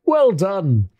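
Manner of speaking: high, hearty shout of praise; Fezziwig. Acted. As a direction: cry out jovially to stop the dance. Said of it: "cried out".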